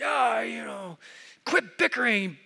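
high-pitched mock dad outburst; re-enacted yell. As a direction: act out blowing up at squabbling kids. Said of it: "I blow up at the dining room table and I yell".

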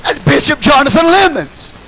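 honouring a bishop, slow and proud; emphatic proclamation. Real, not acted. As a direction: proclaim it slowly to the church.

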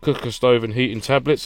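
ordinary delivery

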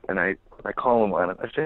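usual speaking voice

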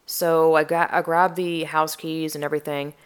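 usual speaking voice

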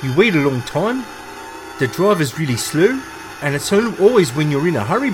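hammed-up New Zealand accent, selling a taxi service; New Zealand accent, taxi ad pitch with bro and cuz; Kiwi accent. Host voicing a Kiwi taxi advert in New Zealand accent. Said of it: "Do you think your Auckland accent is good, bro?".

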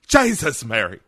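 exclaimed in a put-on Irish accent; mock-exasperated Irish exclamation. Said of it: "your Irish impression".

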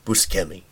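"Buscemi" with a hard sk, boo-SKEM-ee; another pronunciation. Shown as a demonstration. Host guessing at the name, "Buscemi" said with sk. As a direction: say "Buscemi" with a k after the s. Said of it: "or is it".

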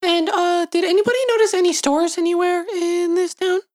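high, squeaky character voice. Acted. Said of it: "did your voice stones drop".

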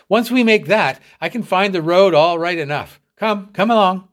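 high, loud and eager; excited. A narrator's reading. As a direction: urge him on with excitement. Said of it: "With a shout of joy".

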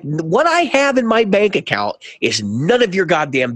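each word laid down with irritation; annoyed retort. Retold or imitated. Snapping at the pushy salesman, slow and irritated. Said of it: "'cause at this point I'm getting pissed off".

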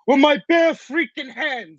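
hammed-up Rocky growl of a line. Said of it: "as Barry would say".

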